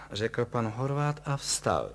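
ordinary delivery, nothing marked

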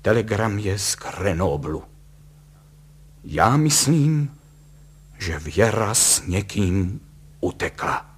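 theatrical doom with long pauses; tragic. A narrator's reading. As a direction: deliver it in portentous pieces, sinking at the end. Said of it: "načež řekl tragicky".

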